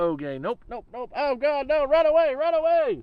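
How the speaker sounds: rising into high, loud frantic panic; mock panic. Imagined dating app users see him and flee, building to panicked yelling. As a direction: act spooked people fleeing in terror. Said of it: "be like".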